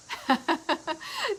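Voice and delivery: high, quick giggle; real high-pitched laugh. Real, not acted. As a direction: burst into a short giggle. Laughs at herself mid-sentence.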